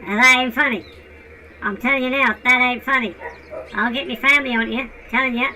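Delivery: low, menacing street voice; low tough voice. Pretend. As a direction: growl out a threat low. Low dog threatens.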